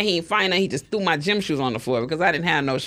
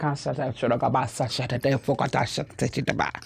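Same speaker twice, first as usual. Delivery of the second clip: rapid-syllable gibberish chant; imitated glossolalia babble; mock speaking in tongues; 6 semitones lower, 5 dB quieter than usual. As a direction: rattle off made-up holy syllables. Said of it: "just started speaking in tongues".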